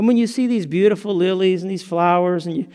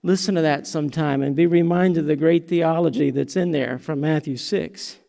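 usual speaking voice

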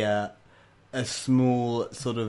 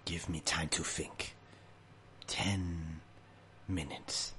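much softer, slow brooding creature voice; salamander creature; 5 semitones lower, 11 dB quieter, slower than usual. Acted. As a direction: say it quietly, buying time. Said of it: "and he says".

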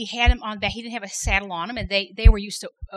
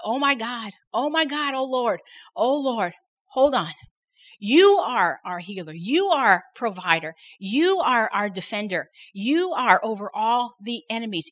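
fervent prayer; 4 dB louder than usual. Retold or imitated. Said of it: "we joined hands and we began to pray".

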